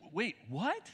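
mildly upset disbelief; taken aback. Retold or imitated. Paul hearing about the rival teachers, taken aback. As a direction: question it as if you can't believe it. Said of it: "he gets upset".

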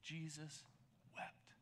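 hushed reverence. Acted. Preacher to the congregation, reading the verse in a hush. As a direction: let the two words fall softly.

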